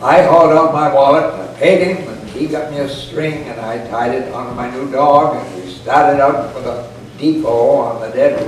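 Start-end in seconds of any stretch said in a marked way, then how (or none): none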